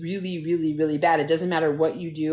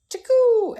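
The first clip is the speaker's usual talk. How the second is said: high, loud imitated "chakoo"; piercing impact noise; 6 dB louder than usual. Storyteller showing glasses hit her head, high loud impact noise. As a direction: fire it off as a sound effect.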